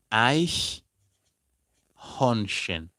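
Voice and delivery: Eichhörnchen stretched very slowly, each syllable held; drawn-out German word. Shown as a demonstration. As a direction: spell out Eichhörnchen in sound, very slowly. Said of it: "like this".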